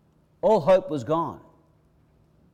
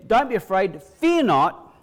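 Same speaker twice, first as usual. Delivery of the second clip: higher, louder command, hit hard; 6 semitones higher, 8 dB louder, slower than usual. Acted. Jesus commanding Jairus to stop fearing, forceful. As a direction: order him out of his fear. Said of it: "directed that straight at Jairus".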